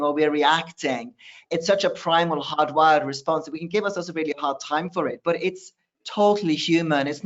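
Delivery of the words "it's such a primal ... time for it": ordinary delivery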